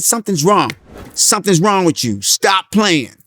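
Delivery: loud, high, emphatic retold scolding; insistent confrontation. Co-star confronting him on set, insistent.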